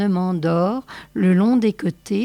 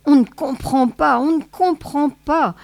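an exasperated, higher protest stressing on; impatient protest; 6 semitones higher, 3 dB louder than usual. A narrator's reading. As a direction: stamp it out impatiently. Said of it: "protesta Jenny en tapant de son pied impatient". (protestation impatiente)

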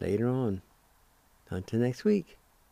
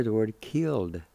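ordinary delivery

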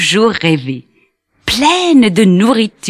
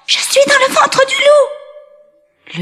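put-on tiny boy calling father. A narrator's reading. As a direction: warn Papa, high. Tom Pouce calls his father.

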